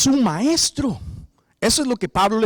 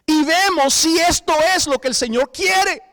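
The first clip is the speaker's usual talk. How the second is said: preaching shout; 8 semitones higher than usual. Real, not acted. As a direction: lift the line up and call it out to the church. Preaching climax. (grito de predicador, más alto y más fuerte)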